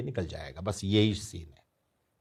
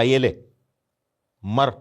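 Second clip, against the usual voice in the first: louder, snapping annoyance; angry retort; 3 semitones higher, 7 dB louder, slower than usual. Retold or imitated. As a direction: shove the pages at him, irritated. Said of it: "गुस्से में बोला".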